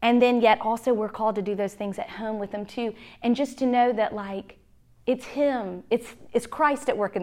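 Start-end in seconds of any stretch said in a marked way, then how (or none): none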